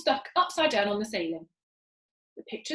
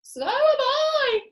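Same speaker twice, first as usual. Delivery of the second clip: very high, loud, slow wail; crying out; 8 dB louder, much slower than usual. Acted. Missus Twit cries out, shrinking too. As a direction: wail it in alarm. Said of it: "cried Missus Twit".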